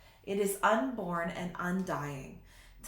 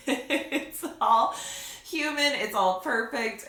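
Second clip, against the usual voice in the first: laughing; 4 semitones higher, 6 dB louder than usual. Real, not acted. Laughing at her messy video.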